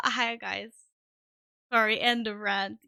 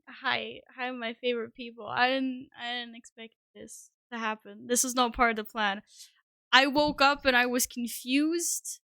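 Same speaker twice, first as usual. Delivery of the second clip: usual speaking voice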